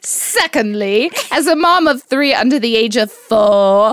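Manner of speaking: mock British accent, breaking into laughs. Podcast mom reads a fan review aloud in a mock British accent. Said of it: "Do your British accent".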